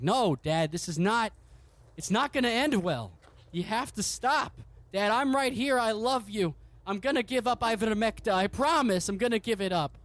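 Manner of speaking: high, earnest pleading son voice. Acted. Son begging his grieving father to let go, high pleading voice. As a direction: beg your father to stop, voice pitched high.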